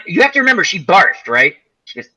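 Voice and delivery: usual speaking voice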